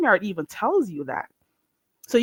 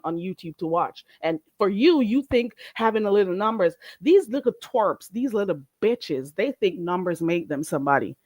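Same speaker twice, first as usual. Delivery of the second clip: ordinary delivery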